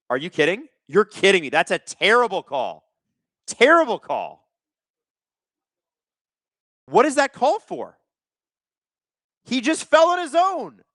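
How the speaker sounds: high, incredulous outrage, climbing as it goes; outraged protest. Real, not acted. Fan disputes a terrible call, real outraged protest.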